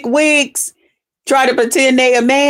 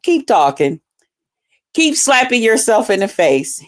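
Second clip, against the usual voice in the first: usual speaking voice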